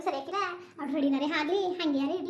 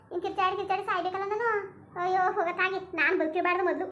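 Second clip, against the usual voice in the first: ordinary talk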